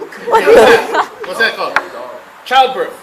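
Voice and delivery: cracking up while naming it; laughing hard. Real, not acted. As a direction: squeal it out between laughs. Laughing at breathing joke.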